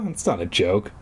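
ordinary delivery, nothing marked

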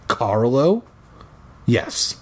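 ordinary delivery

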